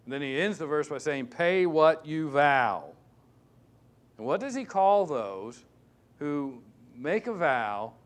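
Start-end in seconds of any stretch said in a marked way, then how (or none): 1.3-2.9 every word driven home slowly and louder; slow emphatic command. Acted. Preacher pressing the verse on his congregation, slow and emphatic. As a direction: lean on PAY and say it slow and strong.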